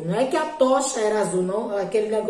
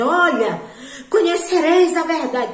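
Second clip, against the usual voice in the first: higher, louder stern scolding; mock angry God; 5 semitones higher, 4 dB louder than usual. What people imagine God saying, a mock stern scolding, higher and louder. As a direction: bark it like a harsh preacher. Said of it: "acha assim que Deus vai chegar e vai dizer".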